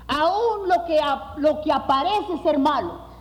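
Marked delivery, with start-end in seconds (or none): none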